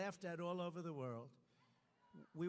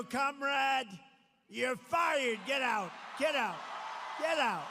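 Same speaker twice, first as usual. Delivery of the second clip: louder, higher shouted dismissal; mock firing shout; 5 semitones higher, 9 dB louder, slower than usual. The people firing Harris, loud shouted dismissal. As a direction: throw her out, shouting it. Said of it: "We're gonna go".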